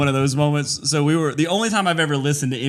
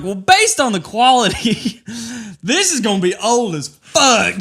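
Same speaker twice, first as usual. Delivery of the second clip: higher, slow and laughing pronouncement; mock expert verdict; 7 semitones higher, 4 dB louder, slower than usual. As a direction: judge it like a know-it-all. Retold smug verdict.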